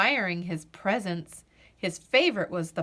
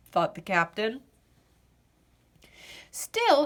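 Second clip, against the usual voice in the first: usual speaking voice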